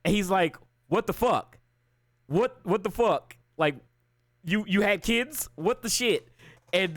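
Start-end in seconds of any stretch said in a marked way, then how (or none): 0.9-6.3 higher-pitched, imitating stunned disbelief; mock disbelief. Retelling Peter finding MJ has kids, mock disbelief. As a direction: act out a baffled, halting double take. Voices Peter learning of betrayal.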